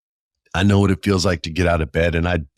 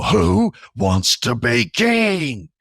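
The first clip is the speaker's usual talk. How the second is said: slow, much higher booming Viking king; 11 semitones higher, much slower than usual. Retold or imitated. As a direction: roar it like a Viking warlord. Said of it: "like my man from the Viking show".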